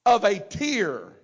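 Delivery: usual speaking voice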